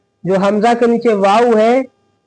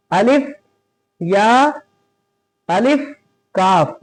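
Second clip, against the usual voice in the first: "अलिफ या अलिफ काफ" letter by letter, a pause after each; अलग-अलग अक्षर; slower than usual. Shown as a demonstration. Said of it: "इसको अलग अलग पढ़ेंगे". ("अलिफ या अलिफ काफ" हर हर्फ़ अलग, रुक-रुक कर)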